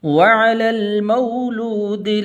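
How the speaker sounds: full tajweed recitation with long held vowels; Quran recitation. Acted. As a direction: recite the whole passage as a flowing chant. Full recitation after the lesson.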